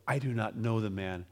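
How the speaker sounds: a hushed second denial; denial under oath. A narrator's reading. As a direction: let it drop away quietly. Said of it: "with an oath".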